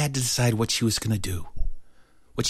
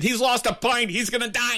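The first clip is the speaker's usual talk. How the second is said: put-on panicky kid; 12 semitones higher than usual. Kid panics over bleeding classmate.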